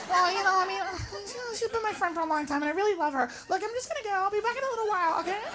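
put-on timid girl defending her friend, softly. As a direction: shrink back and stand up for her softly.

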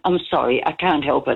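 usual speaking voice

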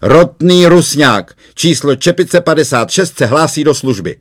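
a jolly new sergeant booms his arrival from the doorway; loud call. Acted. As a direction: announce yourself at full volume like a cheery sergeant. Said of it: "a zvolala".

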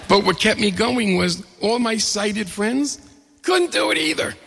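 amused, voice climbing with laughter; laughing. Real, not acted. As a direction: laugh out the punchline. Laughing through the golf punchline.

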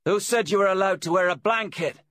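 high, loud challenge; demanding. A narrator's reading. Voice from the caravan challenges the visitor over a blanket, demanding. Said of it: "the voice from the caravan demanded".

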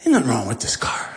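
whispered, low, to himself; whispered self-talk. Retold or imitated. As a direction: whisper it to convince yourself. Reassuring himself while driving.

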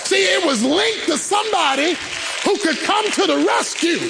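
high, shouted sermon delivery; full-force preaching. Real, not acted. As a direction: drive the point home to the congregation.

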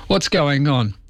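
ordinary talk

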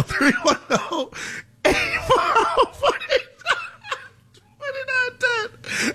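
very high, slow squeal of laughter; helpless laughing. Real, not acted. Host attempting the call-in number, cracking up. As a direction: barely get the number out for laughing. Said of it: "I'm laughing because".

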